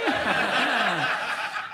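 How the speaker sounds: high laugh at own joke; real amused laugh; burst of laughter. Real, not acted. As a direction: crack up at the punchline.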